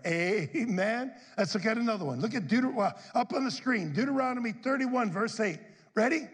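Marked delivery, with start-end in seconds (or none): none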